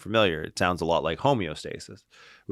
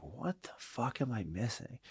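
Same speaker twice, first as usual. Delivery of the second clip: hushed, higher-pitched disbelief; baffled aside; 4 semitones higher, 13 dB quieter than usual. Retold or imitated. Trainer puzzling over clients not improving, hushed and baffled. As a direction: ask it under your breath, as if to yourself. Retold baffled thought to himself.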